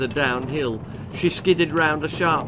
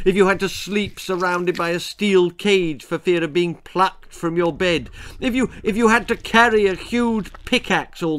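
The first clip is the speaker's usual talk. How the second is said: louder, building indignant rant; ranting bogeyman; 3 semitones higher, 4 dB louder than usual. Acted. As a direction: pile on the grievances. Bogeyman rants at Dee.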